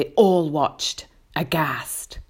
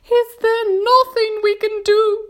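high, loud ladybird plea; appealing; 7 dB louder than usual. A narrator's reading. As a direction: plead for help in a high voice. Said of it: "appealing to James".

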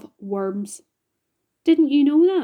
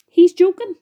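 high, very loud exclamation; loud disbelief; 9 semitones higher, 13 dB louder than usual. A narrator's reading. Creature dismissing James's plan, loud high disbelief.

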